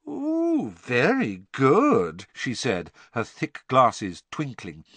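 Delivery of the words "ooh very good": high, drawn-out swooping ghost voice; teasing praise from a ghost girl. Acted. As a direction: coo it slowly, high at the start. Myrtle impressed by Harry's guess.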